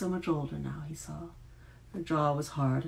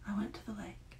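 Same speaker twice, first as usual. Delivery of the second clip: much softer whispered I went to the lake, higher; hushed whisper; 5 semitones higher, 9 dB quieter than usual. A narrator's reading. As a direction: breathe I went to the lake as a secret. Whispered repeat of the line.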